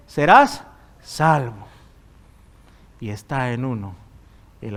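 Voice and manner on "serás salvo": slow, firm and louder; drawn-out declaration; slow emphatic promise. Acted. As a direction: land the promise slowly and firmly. Punchline of the scripture verse.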